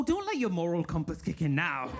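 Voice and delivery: ordinary talk, nothing marked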